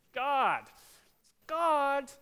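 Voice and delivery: hammed-up child calling God; kids shouting. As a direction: shout it out like an eager child who knows the answer. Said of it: "And I ask them".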